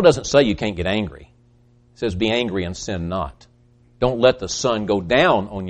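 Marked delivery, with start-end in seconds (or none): 2.1-3.5 quiet quote. Acted. Quoting scripture softly.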